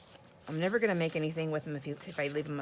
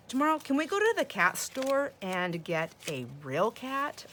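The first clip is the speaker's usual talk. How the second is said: put-on higher, slower, deliberate little-boy question; grandson's serious voice; 6 semitones higher, 4 dB louder, slower than usual. Grandson asks grandma for a real cat, serious big-boy voice. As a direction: imitate an earnest grandson, pitched up, spelling out the request. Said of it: "this is his serious voice now".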